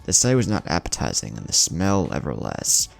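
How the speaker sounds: plain reading voice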